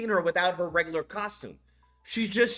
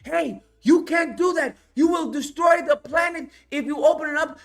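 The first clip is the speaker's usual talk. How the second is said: higher, louder shouted warning; mock frantic warning; 9 semitones higher, 6 dB louder than usual. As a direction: yell a desperate warning. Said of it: "trying to tell him".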